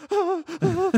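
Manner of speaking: high, loud, strained mock panic; hammed-up falsetto panic; panicked squeak. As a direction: squeal it out like you can't cope. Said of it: "I was like".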